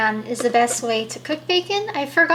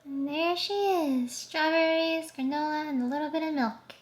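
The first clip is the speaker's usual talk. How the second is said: usual speaking voice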